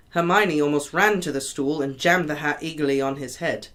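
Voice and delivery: ordinary delivery, nothing marked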